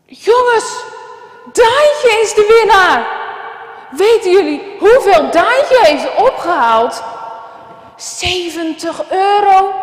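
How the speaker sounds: loud, high, slow teacher proclaiming; excited announcement. Acted. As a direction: announce the winner to the whole hall.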